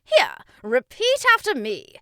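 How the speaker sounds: high, booming instruction; encouraging king. A narrator's reading. King starts teaching the boy, loud encouragement. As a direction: coach loudly and eagerly. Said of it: "King Shumiwami encouraged".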